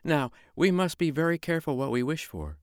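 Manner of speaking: high wife's voice, cautious; the wife warning her husband; careful high-voiced wife. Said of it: "said she".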